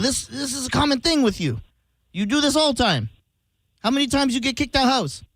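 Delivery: fed-up boss in a put-on Middle Eastern accent. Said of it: "of Middle Eastern descent".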